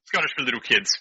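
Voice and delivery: ordinary talk, nothing marked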